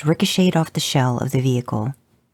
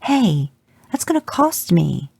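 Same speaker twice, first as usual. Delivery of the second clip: a light scolding, higher pitched; scolded; 5 semitones higher, slower than usual. A narrator's reading. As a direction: tell him off half-joking. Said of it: "you scolded".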